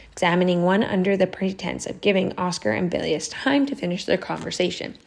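ordinary delivery, nothing marked

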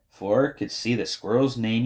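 plain reading voice

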